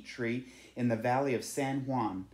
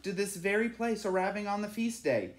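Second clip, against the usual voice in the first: higher, lightly voiced townsman full of wonder; a man in the churchyard marvelling at the swallows; villager telling the legend; 6 semitones higher than usual. A narrator's reading. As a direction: tell it like a treasured local tale. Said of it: "said one man".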